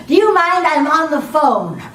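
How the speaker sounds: loud, high, drawn-out mock rebuke; imitated annoyed stranger. Lady on phone to stall neighbour, mock-irritated. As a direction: shoo away an intruder, playing it for laughs.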